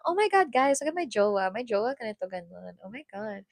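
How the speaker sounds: put-on hushed, low mean-girl parody; soft mocking impression of a bragging mean girl; mock Filipina mean girl. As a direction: trail down softly while mimicking a mean girl. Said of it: "stereotypical Filipino, uh, mean girl".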